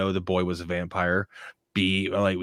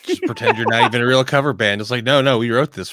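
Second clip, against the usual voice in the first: loud mock insistence; 2 semitones higher, 8 dB louder, faster than usual. Mock band denying a cover.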